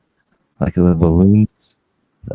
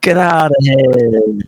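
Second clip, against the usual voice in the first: a put-on New York accent, slightly higher and bolder; a mock New York accent done again on request, given a bit more push; 4 semitones higher than usual. Shown as a demonstration. Asked by the host to repeat it, a slightly hammed-up New York accent. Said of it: "Let's, let's hear it".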